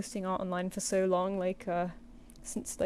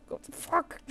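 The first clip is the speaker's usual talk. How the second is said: whispered curse; 8 semitones higher than usual. Retold or imitated. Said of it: "and they're like".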